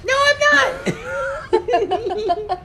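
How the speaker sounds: high, drawn-out laughing denial. Real, not acted. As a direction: laugh through the protest. Laughing denial of reading script.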